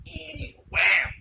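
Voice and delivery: high creak, then loud wham; imitated slamming door. Friend telling what they heard on the wall-reading visit, door sound effects. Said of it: "We hear this".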